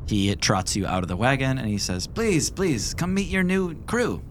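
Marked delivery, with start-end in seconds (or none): 2.1-4.2 Phenom's voice. Acted.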